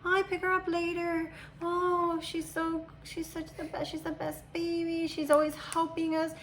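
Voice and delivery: put-on high-pitched gushing over a toddler; imitated daycare ladies. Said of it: "they would all, like, fight with me".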